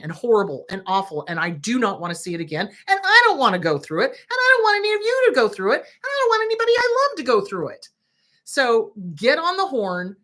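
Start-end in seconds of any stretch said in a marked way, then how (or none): 2.7-7.9 builds much higher and louder, faster; pleading harder with each clause; rising impassioned plea. Real, not acted. Begging viewers to spare loved ones, rising impassioned plea. Escalating plea about respiratory distress.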